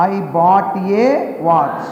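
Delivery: very slow, word by word for the class to copy; slow repeat model. Shown as a demonstration. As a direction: spell it out slowly for the children. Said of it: "మల్లనాలమ్మ". (చాలా నెమ్మదిగా, పదం పదంగా)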